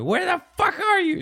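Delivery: exaggerated high-pitched mom yell; hammed-up, very high scolding. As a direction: demand it as a furious caricature of a mother. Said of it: "she's yelling at me".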